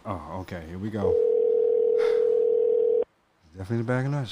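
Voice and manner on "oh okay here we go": ordinary delivery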